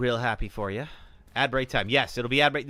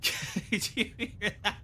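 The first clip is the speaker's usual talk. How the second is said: giggling through the question, slow; laughing; 10 semitones higher, 5 dB quieter, much slower than usual. Real, not acted. Streamer laughing to chat about the character's yawn, giggly and slow. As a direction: laugh while asking chat.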